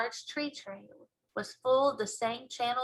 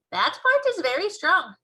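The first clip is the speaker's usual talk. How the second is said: much higher and louder, emphatic; BFG voice; 9 semitones higher, 8 dB louder than usual. Acted. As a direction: giant proudly insisting.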